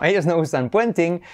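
ordinary talk

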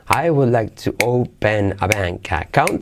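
"I would like to open a bank account" run together smoothly; linked words, flowing. Shown as a demonstration. Teacher showing students linked pronunciation, smooth. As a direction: glide the words into one stream.

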